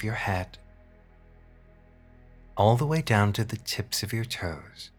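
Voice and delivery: sultry narrator. Acted. Hypnosis session, slow guided relaxation.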